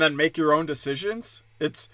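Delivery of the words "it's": ordinary talk, nothing marked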